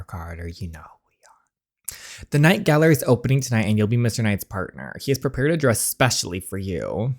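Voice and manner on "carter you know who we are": whispered, low and secretive; mysterious whisper. Acted. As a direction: breathe it like a secret. Game line read mysteriously.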